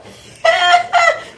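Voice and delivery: loud high delighted laugh; a real delighted cackle; burst of laughter. Real, not acted. Host bursts out laughing at date news.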